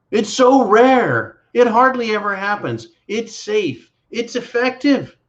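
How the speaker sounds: sarcastic high singsong; mocking sing-song. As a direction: parrot the official line with scorn. Mocking official line.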